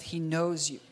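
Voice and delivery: ordinary talk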